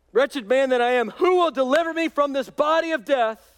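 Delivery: high, loud lament of despair; anguished cry. Acted. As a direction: cry out Wretched man in anguish. Paul's anguished cry, repeated louder.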